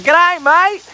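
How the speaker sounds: hammed-up Australian "G'day, mate!", high and loud; mock Aussie greeting. Greeting a passing Aussie girl, hammed-up Australian accent. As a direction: call out like a cheery Aussie. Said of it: "I was like".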